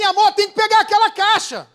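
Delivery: loud, high demand; bossy order. Acted. Wife tells husband to fetch the box, loud bossy demand. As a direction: order him about at full voice.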